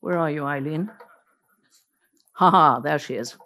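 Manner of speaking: ordinary talk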